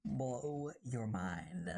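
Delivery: low, drawn-out tagline; slow dramatic echo. Acted. Streamer echoes his own tagline, slow and low. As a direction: stretch it out slowly and low.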